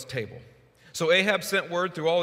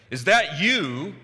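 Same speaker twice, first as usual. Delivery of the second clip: accusing; 5 semitones higher, 7 dB louder, much slower than usual. Retold or imitated. King confronts Elijah.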